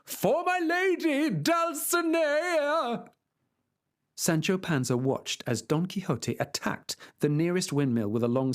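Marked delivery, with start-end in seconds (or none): 0.0-3.1 much higher, slow drawn-out cry; battle cry. Acted. Knight charging the windmill, high drawn-out battle cry. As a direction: cry out the charge, stretching each word.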